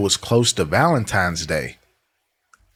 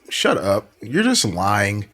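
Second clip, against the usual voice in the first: normal talking voice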